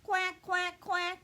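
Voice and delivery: high, loud duck quacks; quacking duck. Acted. Little Duck greeting each guest at the door, high loud quacks. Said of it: "says Duck".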